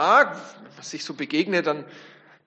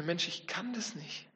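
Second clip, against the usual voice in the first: quiet despair; 14 dB quieter than usual. Retold or imitated. Despairing inner thought.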